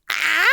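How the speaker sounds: short loud cry of disbelief; overwhelmed yell. Real, not acted. Streamer lost for words after a gift, overwhelmed yell.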